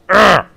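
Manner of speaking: high, loud ugh; exasperated groan. Real, not acted. Gamer fuming at the failed launch, exasperated groan. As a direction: burst of real frustration. Vents frustration.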